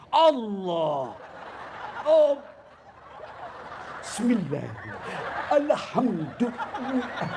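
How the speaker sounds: drawn-out, overdone prayer recitation; show-off pious worshipper. Retold or imitated. Worshipper acting most pious disturbs his neighbour at takbir, hammed-up intoning. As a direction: stretch each holy phrase, hammed up and intrusive. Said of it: "Mulai takbir dia ganggu kita".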